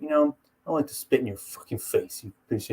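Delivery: low, quiet menace; John Jones. Retold or imitated. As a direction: imitate a fighter threatening softly. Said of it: "he's like".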